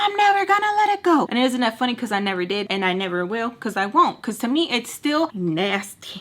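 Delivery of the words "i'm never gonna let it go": very high, defiant retort; stubborn mock wail; retold yelling. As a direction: refuse in a sustained high-pitched voice. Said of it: "we're yelling back and forth".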